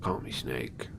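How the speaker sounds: low, deep Snake impression. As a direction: growl it like Solid Snake. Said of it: "I don't know if I could do a snake voice".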